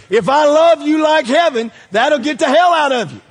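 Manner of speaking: high, loud, emphatic preaching; loud repeat. Real, not acted. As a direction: hammer the line home.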